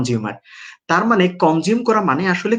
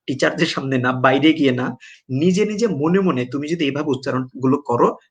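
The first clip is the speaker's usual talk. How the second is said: normal talking voice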